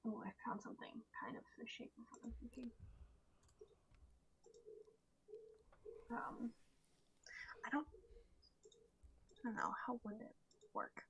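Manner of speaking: quick whispered mutter to self. Real, not acted. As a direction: whisper it under your breath while searching. Muttering while searching Pinterest.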